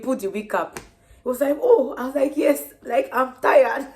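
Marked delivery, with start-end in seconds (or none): 1.6-1.9 very high, loud gasp of surprise; a startled oh; surprised oh. Retold or imitated. Man startled as she takes off her wig, high loud oh. 2.4-3.9 high, loud exasperated yes; tired exclamation. Retold or imitated. Pregnant woman telling the man she's tired, high and loud. Explaining her exhaustion to him.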